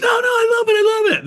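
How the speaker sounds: very high, loud, bright squeal of denial; mock eager squeal. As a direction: squeal it like she can't get enough. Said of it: "And she goes".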